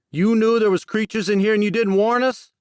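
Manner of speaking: high, loud furious yell; angry shout. A narrator's reading. Jackson yells at Max for hiding the creatures. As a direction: lash out in accusation. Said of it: "Max could hear the anger in his voice".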